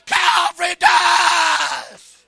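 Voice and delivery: long, very loud shouted proclamation; preaching at full force. Real, not acted. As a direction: cry it out to the whole congregation, drawing out the words.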